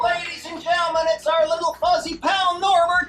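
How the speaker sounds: high loud showman reveal; big reveal announcement. Acted. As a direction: present the rabbit like a grand finale.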